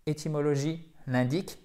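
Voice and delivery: usual speaking voice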